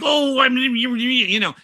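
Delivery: high, loud flustered yelp; sudden high startled cry; flustered squeal. Retold or imitated. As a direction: caught out and squealing. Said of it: "And she's like".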